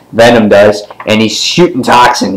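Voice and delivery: normal talking voice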